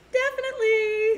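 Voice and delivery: put-on high-pitched read of a viewer's comment. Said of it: "I don't think they would say it that way".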